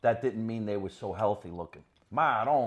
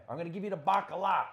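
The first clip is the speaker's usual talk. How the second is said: lightly voiced father's threat, higher and quick; a quick, raised mock threat like Dad's; 7 semitones higher, faster than usual. Son imitating his father promising a beating, mock threatening. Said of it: "My father always used to say".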